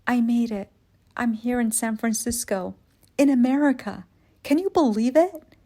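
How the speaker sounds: excited. Retold or imitated. Calling sister from hotel, thrilled.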